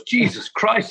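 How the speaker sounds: a hint of old Arnie's dismay; mock exclamation. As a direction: throw up your hands in mock alarm.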